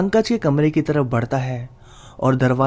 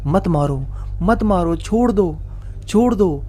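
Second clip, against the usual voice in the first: understated panicked cry, pitched higher; mild narrated yell; 4 semitones higher than usual. A narrator's reading. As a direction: call out pleas with restrained volume. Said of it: "जोर-जोर से चिल्लाने लगता है".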